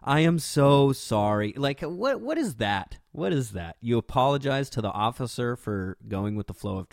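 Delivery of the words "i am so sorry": louder, slow, drawn-out apology; gushing apology. Retold or imitated. Imitating the driver apologizing to the officer, slow and drawn out. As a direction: stretch every word like a flustered driver. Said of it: "Mairead goes".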